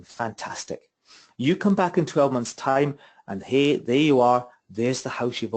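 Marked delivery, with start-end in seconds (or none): 0.0-0.9 whispered. Acted. Narrator echoes builder's word.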